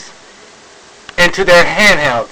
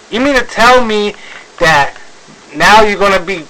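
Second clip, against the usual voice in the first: ordinary delivery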